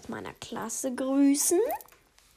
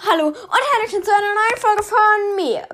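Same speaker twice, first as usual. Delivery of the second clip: excited greeting; 7 semitones higher, 6 dB louder, faster than usual. Real, not acted. Podcast opening greeting.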